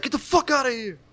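high, mock gruff teacher; teacher's gruff snap. Teacher snapping at the class, gruff mock voice. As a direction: bark it like an annoyed teacher. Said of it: "And he'd be like".

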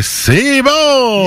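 high, drawn-out "bon" in celebration; game-show host stretching out the verdict; excited announcement. Real, not acted. Host tells contestant the answer is right, excited announcement.

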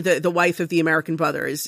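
normal talking voice

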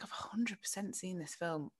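unvoiced hushed whisper; whispering in a cinema, sure she's seen the film. Recalls whispering certainty in cinema.

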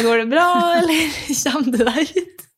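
concerned question with a laugh in it; amused onlookers. Retold or imitated. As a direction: ask if she's okay while trying not to laugh. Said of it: "Og alle bare sånn".

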